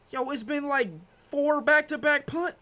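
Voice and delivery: exasperated whine. Real, not acted. Streamer complaining to chat about punts, high exasperated whine. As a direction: stretch it out in disbelief.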